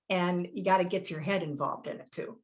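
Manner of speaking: ordinary talk, nothing marked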